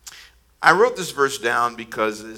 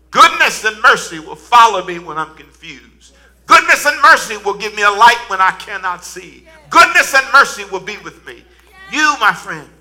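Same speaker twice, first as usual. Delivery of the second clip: loud, higher, near-shouted refrain; climactic preaching; 8 semitones higher, 12 dB louder than usual. Acted. Pastor shouts the refrain to the congregation at the climax. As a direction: hammer "Goodness and mercy" at each peak.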